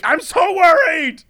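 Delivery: hammed-up fake anxiety; mock worried. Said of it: "to truly be like".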